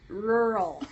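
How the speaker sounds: "rural" with the R's run together; "rural" as one muddy syllable; hard to say. Real, not acted. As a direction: struggle through the R's in "rural". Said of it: "I can hardly say it".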